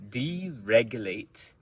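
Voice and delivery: deregulate slowly, punching REG; slow deregulate with a strong second syllable; word stress demo. Shown as a demonstration. Teacher models deregulate for learners, slow, stressed on REG. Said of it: "part of the word that is stress".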